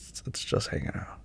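soft unvoiced whisper. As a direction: breathe it out like a secret. Sweet aside about errands.

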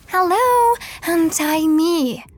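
loud, high, demanding shout; angry yell. Acted. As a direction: yell it, slow and furious. Tied up, yelling at roommate.

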